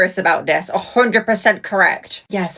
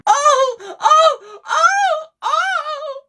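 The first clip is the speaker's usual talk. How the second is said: hysterical crying; much slower than usual. Acted. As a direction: cry out in shock and grief. Acted Big Brother grief scene.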